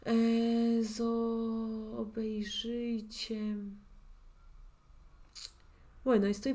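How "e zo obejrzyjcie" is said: drawn-out, softening as it goes; hesitant sounding-out. Real, not acted. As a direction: stretch the sounds while searching for the word. Teacher thinking aloud, sounding out. (alargando los sonidos, pensando en voz alta)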